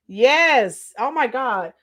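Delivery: ordinary delivery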